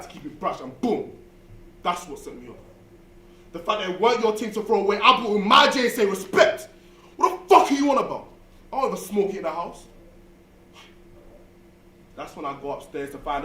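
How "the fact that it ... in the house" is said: furious shout. Retold or imitated. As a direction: yell it at mum. Row with mum, shouting.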